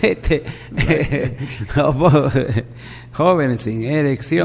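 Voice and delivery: talking through real laughter; laughing. Real, not acted. Host cracks up at his bawdy team-name joke, laughing. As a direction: crack up while saying it.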